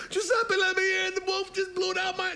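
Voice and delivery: high, loud frantic squeal; panicked pig. Acted. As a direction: pound on the door in a panic. Panicked pig at friend's door.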